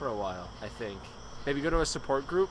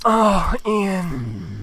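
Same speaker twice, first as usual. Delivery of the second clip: loud; 3 semitones higher, 8 dB louder than usual. Real, not acted. After the loud slurp segment.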